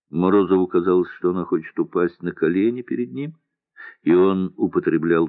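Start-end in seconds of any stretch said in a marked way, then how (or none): none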